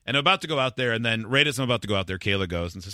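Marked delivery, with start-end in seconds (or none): none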